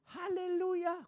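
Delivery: high parrot squawk. Retold or imitated. Parrot greeting the visitor, high voice. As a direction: greet him like a chirpy parrot.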